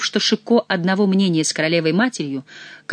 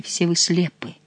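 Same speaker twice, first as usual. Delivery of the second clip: usual speaking voice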